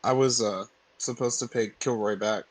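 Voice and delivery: normal talking voice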